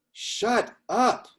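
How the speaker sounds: high, drawn-out Sicilian-Boston grandpa putdown; Sicilian Bostonian grandfather. Retold or imitated. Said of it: "in his, his Sicilian voice, uh, Bostonian".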